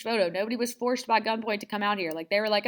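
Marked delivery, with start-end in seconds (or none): none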